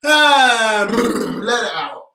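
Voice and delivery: a long relaxed sigh, then let it out; slow calming exhale. Shown as a demonstration. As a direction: breathe it out slowly for them. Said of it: "make funny noises".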